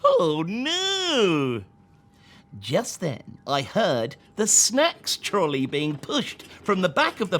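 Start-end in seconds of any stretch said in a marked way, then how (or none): none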